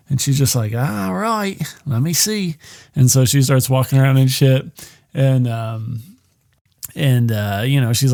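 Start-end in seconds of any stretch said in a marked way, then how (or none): 0.7-2.6 higher, softer mock Australian woman; Australian accent. Woman agreeing to look for her date, put-on Australian accent. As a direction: imitate an agreeable Australian, pitched up. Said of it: "she's using her, uh, Australian accent".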